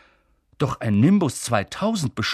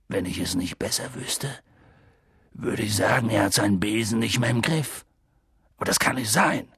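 a hint of Hagrid, lower and puzzled; Hagrid's voice; 3 semitones lower than usual. Hagrid watching Harry through binoculars, lightly voiced.

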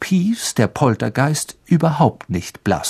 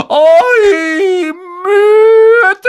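sehr hoch und laut gebrüllt; donnernder Ruf; 7 dB louder than usual. Acted. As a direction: schallend durch den Kerker rufen. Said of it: "rief mit donnernder Stimme". (donnernd gerufen)

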